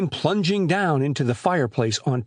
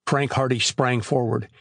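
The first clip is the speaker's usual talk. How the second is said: usual speaking voice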